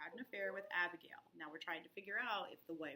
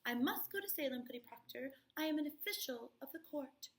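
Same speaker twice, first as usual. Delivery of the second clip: higher and louder, proud and self-important; haughty girl quote; 6 semitones higher, 4 dB louder than usual. Retold or imitated. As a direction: chin up, like a prince's daughter. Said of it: "raises up her chin like the daughter of a prince".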